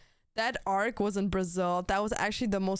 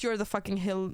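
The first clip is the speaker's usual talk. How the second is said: normal talking voice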